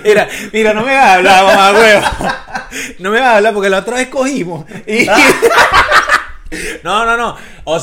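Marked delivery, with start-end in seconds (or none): 0.0-5.1 laughing. Real, not acted. Laughing protest at co-host's joke. 5.1-6.8 high laughing shriek. Real, not acted. Bursts out laughing.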